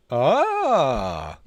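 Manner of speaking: sudden loud startled yelp; startled cry. Real, not acted. Streamer spots his mic floating, loud startled cry. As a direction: yelp in surprise.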